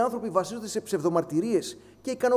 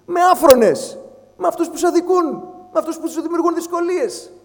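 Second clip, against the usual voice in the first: much louder and higher, hammering each phrase; fiery preaching; 9 semitones higher, 8 dB louder than usual. Real, not acted. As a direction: preach at full force against the wrongdoers. Preaching peak on wrongdoers. (δυνατό, φλογερό κήρυγμα)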